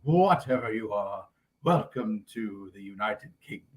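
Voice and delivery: a light impression of a pompous Englishman; stuffy British official. Official greeting the Muppets on landing, slow stuffy British tone. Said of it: "the way he's all like".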